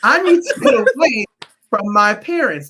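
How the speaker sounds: high, loud pleading student voice; imitating a student. Teacher imitating his Newark students wanting to leave home. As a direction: plead like a teenager who wants out. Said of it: "they said to me".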